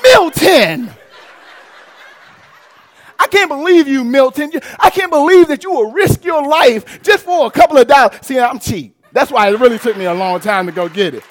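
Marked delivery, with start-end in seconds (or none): 0.0-8.2 imitating his wife scolding him, louder and higher; wife mad at him. Wife scolding husband over the risky worn tires, mock angry shout. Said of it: "how she say my name when she mad at me".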